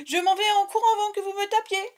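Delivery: high, playful getaway shout; mock fleeing cry. Vlogger running off before viewers hit her, mock fleeing cry. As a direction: call it out high while pretending to bolt. Pretends to flee from angry viewers.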